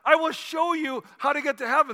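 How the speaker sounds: lightly acted evangelist's emphatic promise; Billy Graham. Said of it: "And so Billy Graham says".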